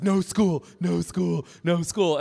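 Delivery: lightly voiced toddler begging not to go; toddler refusing school. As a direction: mimic a two-year-old wailing no school. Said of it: "and he's like".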